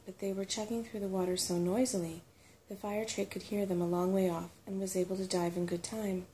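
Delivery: ordinary delivery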